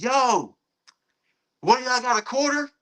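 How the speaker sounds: high-pitched call down the street; retold yell. Friend to a kid down the street, retold yell. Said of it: "he yells out".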